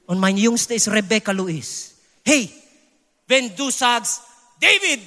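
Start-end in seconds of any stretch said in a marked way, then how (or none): 4.6-5.0 high-pitched call to a son; pitched-up summons; calling out a name. Acted. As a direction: call "David" out as if summoning him. Calling his son's name as example.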